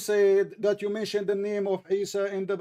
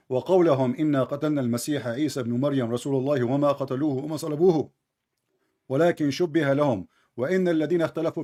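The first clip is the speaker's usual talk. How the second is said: quick, lower-pitched Arabic recitation; Quran verse reading; 4 semitones lower, much faster than usual. Acted. Debater proving his Arabic to a challenger, rapid verse reading. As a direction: rattle off the verse in fluent Arabic.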